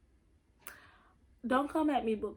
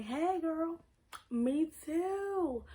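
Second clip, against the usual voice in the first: higher-pitched, drawn-out sisterly call; playful commiserating; 6 semitones higher, much slower than usual. Acted. Reader to anxious book heroine, playful commiseration. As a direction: greet her like a kindred girlfriend. Relating to book character as if chatting.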